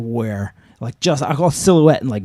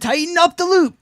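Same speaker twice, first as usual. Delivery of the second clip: called-up order. Retold or imitated. Rescuer tells the old lady to tighten the loop, calling up. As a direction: shout it up to the window. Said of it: "I said".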